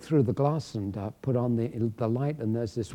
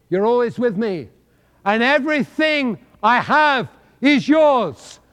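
proclaimed promise; 10 semitones higher, 9 dB louder than usual. Acted. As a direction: announce the promise to the whole room. Father's promise proclaimed loudly.

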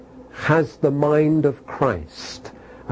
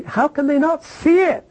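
much higher, pleading question; exasperated cry; 14 semitones higher than usual. Real, not acted. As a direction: throw it up in disbelief.

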